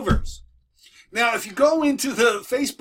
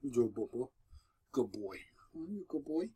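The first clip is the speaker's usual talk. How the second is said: soft praise; 7 semitones lower, 13 dB quieter than usual. Real, not acted. Praising the dog quietly.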